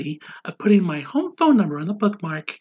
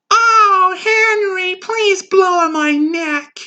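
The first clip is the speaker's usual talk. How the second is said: high crying moan, slow; passionate moan; 11 semitones higher, 4 dB louder, much slower than usual. Acted. Wife moaning to husband in bed, high crying moan.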